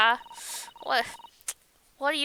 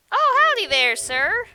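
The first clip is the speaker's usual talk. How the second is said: much higher, loud, forced-jolly hello; overly cheery greeting; 8 semitones higher, 8 dB louder than usual. Acted. Inspector caught eavesdropping, forced loud cheer. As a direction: cover being caught with a big hello.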